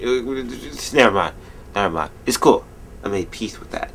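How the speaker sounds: normal talking voice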